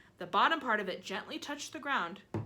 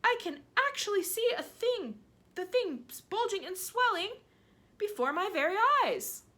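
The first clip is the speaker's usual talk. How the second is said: excited; 13 semitones higher, 6 dB louder than usual. Acted. Aunt marvelling loudly.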